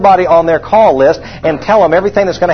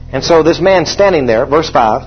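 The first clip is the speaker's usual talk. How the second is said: ordinary delivery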